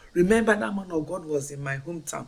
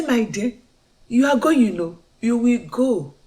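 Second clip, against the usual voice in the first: higher, louder happy promise; joyful reassurance; 6 semitones higher, 7 dB louder than usual. Retold or imitated. Mother assuring her son he will go, higher and louder. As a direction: tell him gladly he will go.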